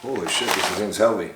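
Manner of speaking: normal talking voice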